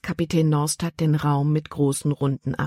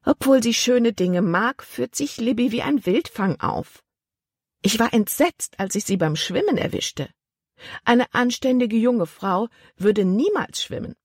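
höhere, vornehme ältere Tante; 7 semitones higher than usual. A narrator's reading. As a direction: eine besorgte Dame der Gesellschaft, hoch gesprochen. Tante Vi spricht. (Tante Vi klagt beim Kapitän, mit höherer Stimme)

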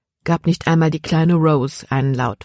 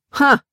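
sharp scornful ha, pitched up; mocking ha; 10 semitones higher, 4 dB louder than usual. A narrator's reading. Sam scoffs at the slave boy Jordan, mocking ha. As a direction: scoff it out in one short burst. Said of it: "spottete Sam".

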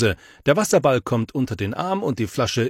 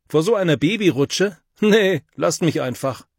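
high, louder scoffing brush-off; defensive bluster; 7 semitones higher, 4 dB louder than usual. A narrator's reading. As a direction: fake bravado, wave them off. Boy bluffs defensively. (trotzig abwehrend)

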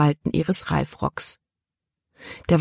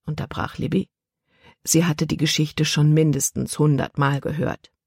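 usual speaking voice

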